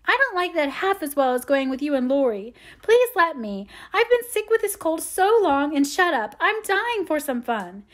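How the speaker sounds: put-on high-pitched, begging child; pathetic pleading. A narrator's reading. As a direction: beg as pitifully as you can. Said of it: "pleaded Amy, looking as pathetic".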